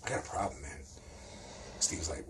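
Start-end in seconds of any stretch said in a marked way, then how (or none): 0.0-0.8 soft, low confession; real quiet. Retold or imitated. Bill confessing to friends during a traffic stop, quiet. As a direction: keep it down, like a secret admission. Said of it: "Bill finally says real quiet".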